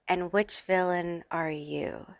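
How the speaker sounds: hushed teasing whisper. Pretend. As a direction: breathe the question softly. Teasing viewers about villain era.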